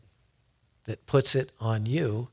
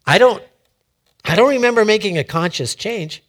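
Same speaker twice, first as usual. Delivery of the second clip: higher, louder acted puzzlement; raised voice of someone stumped; mild bafflement; 7 semitones higher, 7 dB louder, faster than usual. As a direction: protest it as if genuinely puzzled. Acts out baffled inner reply.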